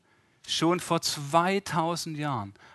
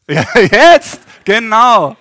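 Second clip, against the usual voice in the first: excited shout; 8 semitones higher, 18 dB louder, much slower than usual. Real, not acted. Laughing exclaim now.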